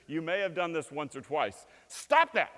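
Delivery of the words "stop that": high, loud parental scold. Retold or imitated. Parent calling a child to attention, loud scold. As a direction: snap at a child. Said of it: "if you have kids".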